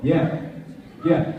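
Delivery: ordinary talk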